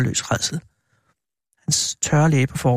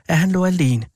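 ordinary delivery, nothing marked

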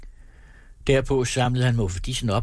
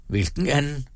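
higher, caught off guard; surprised; 6 semitones higher than usual. A narrator's reading. Macario puzzled by the clerk's claim, surprised. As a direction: ask it with honest surprise. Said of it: "med overraskelse i stemmen".

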